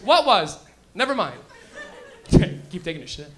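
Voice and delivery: normal talking voice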